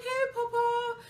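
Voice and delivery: high, imitated teary singsong; tearful greeting. Daughter finally hearing her dad, imitated tearful voice. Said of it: "the tears were just flo-, flowing".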